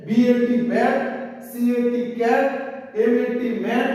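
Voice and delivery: teacher spelling out ক্যাট and ম্যাট letter by letter, then the word; letter-by-letter spelling drill with the word said after it; spelled-out demo. Shown as a demonstration. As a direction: spell each letter, then say বি এ টি ব্যাট with a clear flat a in ব্যাট. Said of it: "এইভাবে কিন্তু এ উচ্চারণ হচ্ছে".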